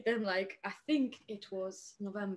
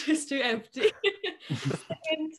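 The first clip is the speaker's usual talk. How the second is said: laughs through it, higher and louder; laughing; 8 semitones higher, 6 dB louder than usual. Real, not acted. Guest joking about her bare wall, laughing. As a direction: giggle the words out.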